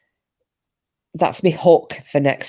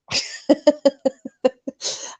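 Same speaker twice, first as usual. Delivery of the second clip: laughing; 10 semitones higher than usual. Real, not acted. Laughing at own impression.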